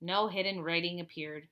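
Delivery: ordinary delivery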